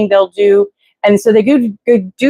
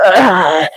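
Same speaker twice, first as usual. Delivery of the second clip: groan. Retold or imitated. Overwhelmed by inspection season.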